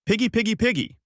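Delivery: shouting out. A narrator's reading. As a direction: call out high as if summoning a pig. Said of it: "Bulma then shouts out".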